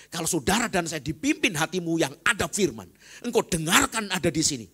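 loud, high preaching climax; fiery sermon peak; preaching at full force. Acted. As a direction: proclaim it to the whole church. Preacher raises voice.

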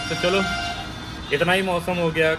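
ordinary talk

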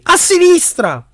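high, drawn-out warning cry; slow, high-pitched yelled warning. A narrator's reading. Harry warns Cedric which side the danger is on, yelling. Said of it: "urlò Harry".

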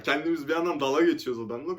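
ordinary talk

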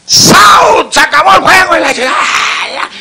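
very high shouted out call; game-over call. Acted. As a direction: yell it like the umpire ending the game. Ampáyer canta el último out. (grito del ampáyer, muy agudo y fuerte)

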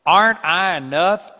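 loud, booming question from above. Retold or imitated. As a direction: ask it like God shaking the ground. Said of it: "he repeated it".

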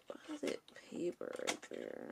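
soft puzzled whisper under her breath; whisper to self. Real, not acted. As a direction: whisper it to yourself, baffled. Puzzled muttering at jammed paper.

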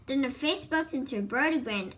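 ordinary delivery, nothing marked

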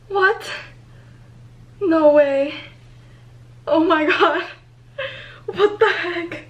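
loud, high cries of disbelief; shocked disbelief. Real, not acted. Site kicks her out, disbelief.

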